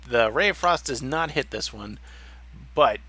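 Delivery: ordinary delivery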